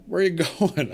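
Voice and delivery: high, baffled question with a laugh; confused surprise. Retold or imitated. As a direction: ask it puzzled, half laughing. Confused at wife calling ambulance.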